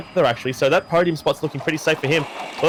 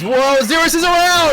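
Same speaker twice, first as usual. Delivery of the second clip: high, loud race call as the horse spins; excited shout; 11 semitones higher, 5 dB louder than usual. Real, not acted. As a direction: yell it out as he spins around.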